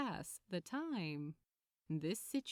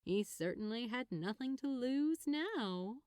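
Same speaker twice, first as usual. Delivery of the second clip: usual speaking voice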